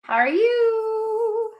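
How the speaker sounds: high, slow sing-song greeting. Real, not acted. Greeting viewers at stream start.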